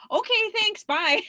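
high, loud sing-song brush-off; hammed-up cheery farewell to the bosses; mock chipper goodbye. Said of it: "We're like".

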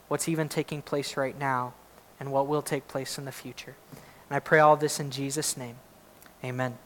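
soft, hushed prayer before the sermon; pulpit prayer. Real, not acted. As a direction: pray quietly and humbly to God.

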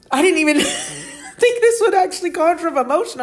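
choked up, half laughing through real tears; voice breaking with emotion. Real, not acted. Podcast host surprised by her own tears, voice breaking. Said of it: "weirdly getting emotional about this".

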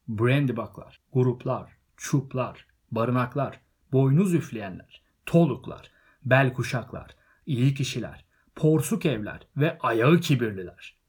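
loud oratorical list, each name set apart; speech roll-call. A narrator's reading. Bilbo greeting hobbit families from a chair, loud ceremonial roll-call. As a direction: hail each family in turn, punching the names.

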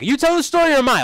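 high, loud mock outburst; retelling Ross losing it at his date; mock freak-out. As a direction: snap back at her in a hammed-up rage. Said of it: "he f-, freaks out on her".

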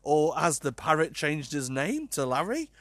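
normal talking voice

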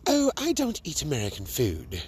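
put-on British accent. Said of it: "this British woman".

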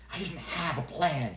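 ordinary delivery, nothing marked

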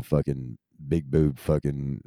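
normal talking voice

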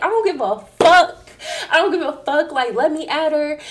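high laughing mock yell of "I don't give a fuck"; half-laughing, shout it like you're being held back; imitating her drunk self yelling, laughing through it; drunken yell.

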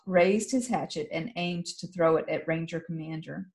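ordinary delivery

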